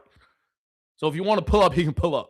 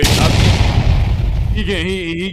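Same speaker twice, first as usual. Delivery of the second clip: a much louder, breathy cry; loud interjection; 3 semitones higher, 9 dB louder, much slower than usual. Real, not acted. S0 cuts in over S2, loud breathy burst. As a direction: shout to cut in over the others.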